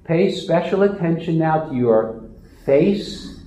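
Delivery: ordinary talk, nothing marked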